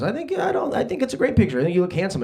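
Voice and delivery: high-pitched placating voice; mock a friend's squeaky protest; defensive friend. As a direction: falsetto reassurance, easing down. Friend defending his picture, acted.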